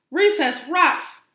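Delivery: loud, slow, high bellow. Acted. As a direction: belt it out like a rowdy shark. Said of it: "bellowed Clark the Shark".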